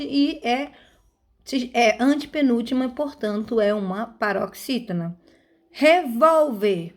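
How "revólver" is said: slow Revólver with a strong VÓL; teacher's demo, VÓL stressed in Revólver; stressed middle syllable. Shown as a demonstration. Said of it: "é a sílaba mais forte".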